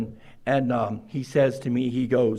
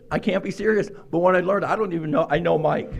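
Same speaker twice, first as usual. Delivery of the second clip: ordinary talk, nothing marked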